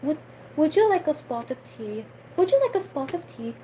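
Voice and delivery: amateur British tea-offer voice; attempted British accent. Shown as a demonstration. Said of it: "a British accent is, like, really hard for me".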